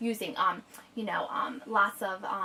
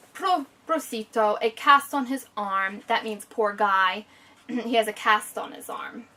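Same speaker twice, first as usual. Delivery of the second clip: usual speaking voice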